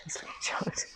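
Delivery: hushed, giggly aside; laughing whisper. Real, not acted. Host teasing her husband about upgrades, laughing whisper. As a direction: whisper it through a suppressed laugh.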